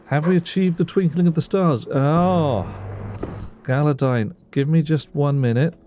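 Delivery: grand lordly voice. Pretend. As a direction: play a pompous lord answering his court. Greeting game character in mock grandeur.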